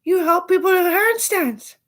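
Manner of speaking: very high, loud, slow squeal; mocking her. Her amazed question in a very high loud slow squeal. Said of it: "She's like".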